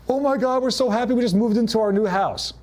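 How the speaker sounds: high, gushing buyer bragging about the new house; mock gushing joy. Buyers posting online, gushing with high excitement. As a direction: squeal it like a thrilled new homeowner.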